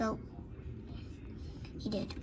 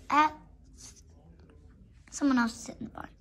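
normal talking voice

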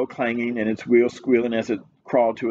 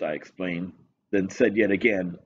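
usual speaking voice

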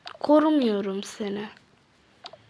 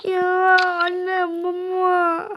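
higher wailing cry for mom and dad; hammed-up sob for parents; mock crying; 6 semitones higher, much slower than usual. Asansörde ağlayarak yakarış. (abartılı ağlama)

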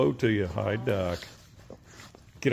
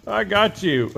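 laughing tease; 9 semitones higher, 7 dB louder than usual. Real, not acted. Teasing coyote, laughing.

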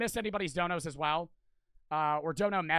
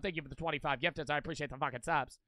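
ordinary talk, nothing marked

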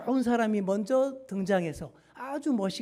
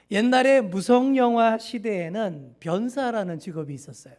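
ordinary talk

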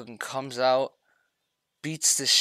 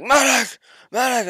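much higher, much louder desperate yell; calling for a medic; 13 semitones higher, 12 dB louder than usual. Retold or imitated. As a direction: yell across the battlefield for help. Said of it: "I'm like".

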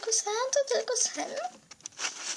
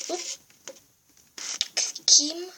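usual speaking voice

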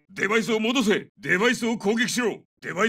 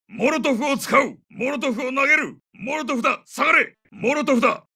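high, raised-voice battlefield warning; urgent combat callout; 6 semitones higher than usual. Acted. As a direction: warn the squad of incoming danger. (緊迫した戦闘中の叫び)

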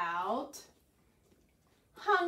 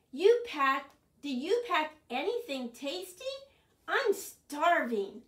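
raised, louder hungry plea; hungry pleading; 5 semitones higher, 8 dB louder than usual. Acted. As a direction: beg a friend for food. Hungry Bird begs for food.

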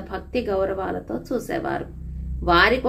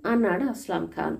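ordinary delivery

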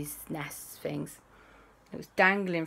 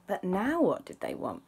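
usual speaking voice

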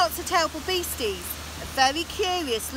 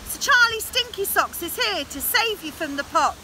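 higher heroic announcement; heroic cry; 6 semitones higher than usual. A narrator's reading. Knight saving the princess from the pot, heroic cry. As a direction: proclaim a bold rescue. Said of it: "cried the knight".

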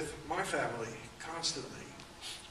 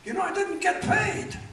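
much higher, louder imitated voice with a laugh; the little violinist complaining, pitched up, chuckling; 8 dB louder than usual. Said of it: "He said".

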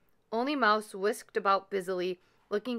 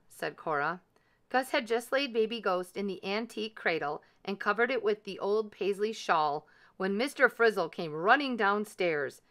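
ordinary delivery, nothing marked

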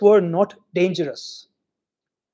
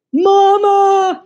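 high loud wail for mama; 12 semitones higher, 6 dB louder than usual. Acted. Frightened Grisha wailing for his mama, high and loud. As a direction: cry out for mother in distress. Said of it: "he wailed".

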